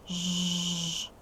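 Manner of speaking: Ж held as a long, even buzz; isolated consonant. Shown as a demonstration. Teacher isolating a sound for pupils, Ж held long. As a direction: sustain Ж alone with no vowel. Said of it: "Дзвінкий".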